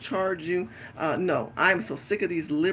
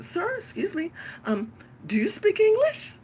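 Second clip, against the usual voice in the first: much higher, prim and innocent. Pretend. Woman in the market mocks the men, prim high lady voice. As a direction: ask it all sweet and fake-polite.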